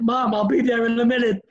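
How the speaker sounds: calling out. Retold or imitated. Boy answering his mother's dinner call, calling out. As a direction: call through the house to mom.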